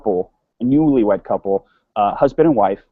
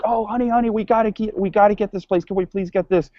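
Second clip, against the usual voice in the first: high, eager begging as an excited wife; excited pleading; 8 semitones higher than usual. Retold or imitated. Wife begging her husband to get the house, excited pleading. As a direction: plead in a raised pitch, imitating an excited wife. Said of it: "she gets so excited".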